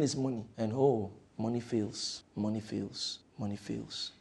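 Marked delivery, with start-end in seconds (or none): 1.4-4.1 fading repetition. Real, not acted. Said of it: "repeated fading".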